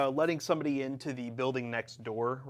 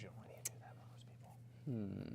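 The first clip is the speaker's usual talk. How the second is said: whispered, half to himself; whispering; 5 semitones lower, 13 dB quieter than usual. Real, not acted. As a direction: drop to a whisper for the aside. Aside said unvoiced.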